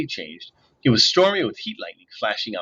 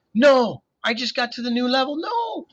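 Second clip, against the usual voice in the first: much higher, desperate protest; whining shout; 13 semitones higher than usual. A narrator's reading. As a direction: wail like a kid torn from his game. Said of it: "as he shouted".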